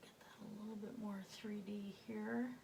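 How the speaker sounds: soft whisper to himself. Real, not acted. Painter whispering to himself as he adds shading. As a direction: breathe the words while shading.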